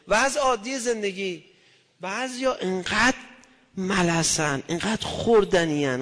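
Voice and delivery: normal talking voice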